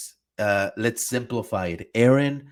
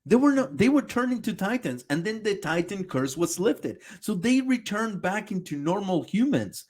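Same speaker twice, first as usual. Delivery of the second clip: angry rant; 8 semitones higher than usual. Real, not acted. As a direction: tear into him, furious. Yelling at the video.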